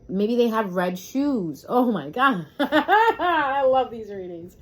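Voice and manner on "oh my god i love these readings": slow laughing gush, louder; delighted laugh. Real, not acted. Reader gushes with laughter over the red shoes card, slow and louder.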